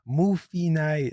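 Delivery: put-on very slow toddler phrasing; imitating a tot just learning words; toddler imitation. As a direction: stretch each syllable like a little kid. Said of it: "she was like".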